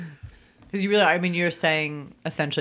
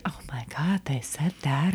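whispering; 4 dB quieter than usual. Retold or imitated. Imagined shocked audience member.

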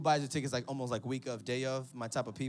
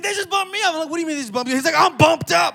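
mock shouting back and forth; 13 semitones higher, 19 dB louder, faster than usual. Brothers arguing on the phone.

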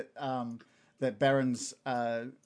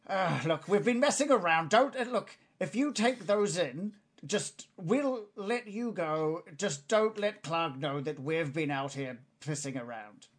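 high, slow goblin voice; bargaining goblin; 3 semitones higher, slower than usual. Acted. Goblin asking intruders to keep a secret, high bargaining voice. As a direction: plead a deal in a pitched-up creature voice.

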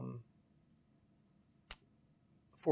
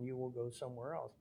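ordinary delivery

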